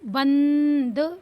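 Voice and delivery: बंद with a long held n, द released separately; drawn-out anusvara. Shown as a demonstration. Teacher reading बा with the dot, drawn-out anusvara. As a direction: hold the nasal in बंद slowly. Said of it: "छोटी अंग का उच्चारण क्या".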